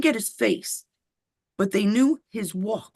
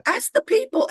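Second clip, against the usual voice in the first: much higher, louder insistence; emphatic repeat; 14 semitones higher, 3 dB louder than usual. Real, not acted. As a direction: insist on it, pitch jumping up. Repeats his demand, much higher.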